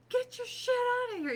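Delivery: high indignant mock yell; mock yell at husband. Telling husband to clear his stuff, high mock yell. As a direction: bark it at him playfully. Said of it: "this tiny little".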